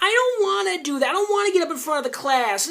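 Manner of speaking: high whiny mock of a reluctant kid; mocking whiny student. Friend mocks his complaint in a high whiny voice. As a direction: whine it up high like a sulky teen. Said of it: "only time I'm gonna make fun of you".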